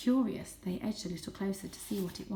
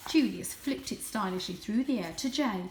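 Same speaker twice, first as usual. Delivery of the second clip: usual speaking voice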